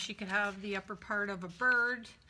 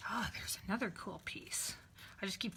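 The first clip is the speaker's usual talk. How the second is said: whisper; 10 dB quieter than usual. Real, not acted. Whispering over found pieces.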